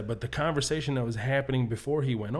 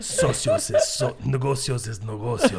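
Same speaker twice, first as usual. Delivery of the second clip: playful Mexican Spanish; 3 semitones lower than usual. Pretend. Mock Mexican Spanish banter.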